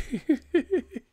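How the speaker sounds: high shrieking laughter. Real, not acted. Laughing at chat comment.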